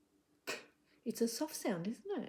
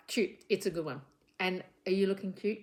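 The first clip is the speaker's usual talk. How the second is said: ordinary talk, nothing marked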